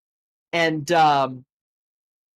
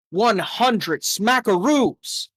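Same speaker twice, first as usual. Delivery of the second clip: higher, very slow cartoonish drawl; goofy wrong line; 6 semitones higher, much slower than usual. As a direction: ham it up like another character. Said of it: "the lines bleeding through".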